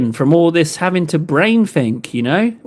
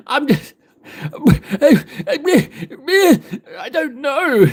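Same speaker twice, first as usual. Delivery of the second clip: mock despair; 8 semitones higher, much slower than usual. Exasperated plea to Fundy.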